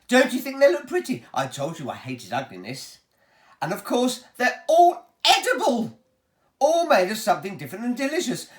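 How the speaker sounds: high, loud showman boasting; a proud host showing off his pipes; Willy Wonka voice. Acted. Wonka booms to the children about his pipes, showman voice.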